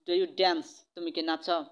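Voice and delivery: ordinary talk, nothing marked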